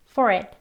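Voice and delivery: "for it" joined as one word, "fo-rit"; short, connected "for it"; linked sounds. Shown as a demonstration. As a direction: carry the r of FOR into IT. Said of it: "하고 발음하면 되겠습니다".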